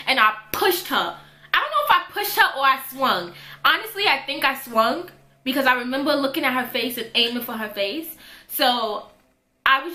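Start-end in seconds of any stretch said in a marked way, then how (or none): none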